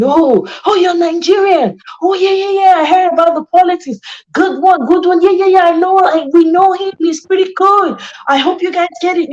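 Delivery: high-pitched impression of chatty outsiders; mock curious foreigner. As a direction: imitate eager foreign small talk, pitched well up. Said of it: "People come, they ask me".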